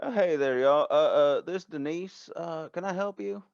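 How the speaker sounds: high voice, hesitant phone greeting; Denise. Acted. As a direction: pick up in a high, folksy character voice. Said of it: "you hear a voice pick up the phone and go".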